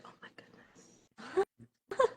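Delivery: slow, breathless whisper of dread; seeing only hours until the alarm, whispered dismay. Retold or imitated. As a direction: sigh the words out under your breath. Reacting to alarm, muttered dismay.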